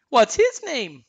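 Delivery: high, loud cartoon question; high-pitched, eager little character; Olaf. A narrator's reading. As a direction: ask loudly in a high snowman voice. Olaf's eager question, same voice.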